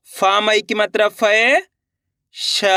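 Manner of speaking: slow drill, "फै" stretched and rising, then "श" alone; letter-by-letter reading. Shown as a demonstration. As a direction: sound out "फै" and "श" separately. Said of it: "मैं किस तरीके से एक अक्षर का उच्चारण करके". (अक्षर-अक्षर, "फै" खींचकर, "श" अलग)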